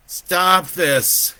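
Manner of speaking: loud, high desperate plea; anguished shout; crying out. A narrator's reading. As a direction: beg loudly for it to stop. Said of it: "he cried".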